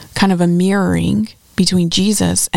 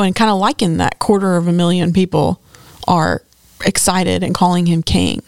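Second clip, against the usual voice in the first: ordinary delivery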